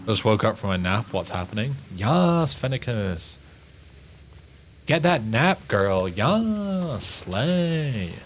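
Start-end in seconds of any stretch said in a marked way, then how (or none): 2.0-8.1 put-on high, swooping "yas girl" hype; hammed-up cheer with stretched vowels; slay voice acting. Cheering viewer who just woke from a nap, hammed-up slay voice. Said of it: "do a slay with your great voice acting".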